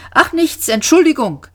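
high, flustered apology; embarrassed. A narrator's reading. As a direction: brush it off awkwardly. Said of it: "sagte Arthur verlegen".

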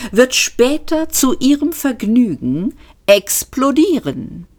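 a lightly pompous waiter; triumphant waiter. Acted. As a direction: land the punchline proudly. Waiter finishes his line.

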